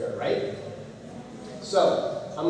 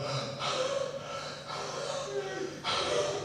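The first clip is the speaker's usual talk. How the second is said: long, high, airy exhausted sigh; 12 dB quieter than usual. Retold or imitated. Wife and daughter worn out on the climb, high exhausted sigh. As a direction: sigh like you can't take another step.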